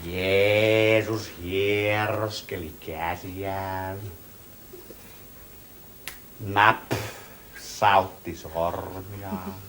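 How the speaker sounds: low, soft and slow, as in church; hushed church quiet. Acted. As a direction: hush it like a sermon is on. Hush like in church.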